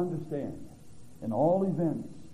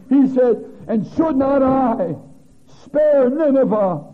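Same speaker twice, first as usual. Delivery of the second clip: full-force preaching; 9 dB louder than usual. Acted. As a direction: thunder out God's questions to Jonah.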